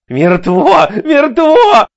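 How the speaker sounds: high shouts with each blow; frenzied shout. A narrator's reading. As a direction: yell it while hacking. Larry hacking at corpse. (исступлённый крик)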